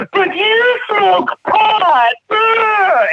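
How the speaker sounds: very high, drawn-out wail; mock crying. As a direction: imitate a classmate bawling an accusation, slow and squeaky. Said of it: "she just starts crying".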